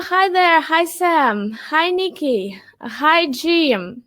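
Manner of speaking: ordinary talk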